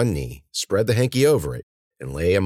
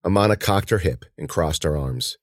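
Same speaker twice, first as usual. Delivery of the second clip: ordinary delivery, nothing marked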